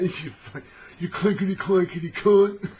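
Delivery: loud, high Cockney jeer; hammed-up Cockney heckling, high and loud; Cockney jailer. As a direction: hurl insults like a rowdy Cockney. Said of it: "the jailers would just be like".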